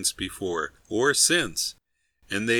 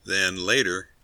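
ordinary delivery

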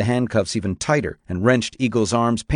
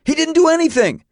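loud, very high-pitched yell; high boy's shouted protest; 5 dB louder than usual. A narrator's reading. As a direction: yell in desperate defense. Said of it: "Jacob yelled".

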